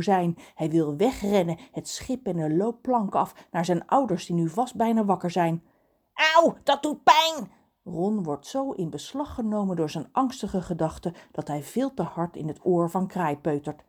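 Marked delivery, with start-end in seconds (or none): 6.3-7.5 high, loud yelp of pain; pained outburst. A narrator's reading. Kraai gets poked in the ear, yelping.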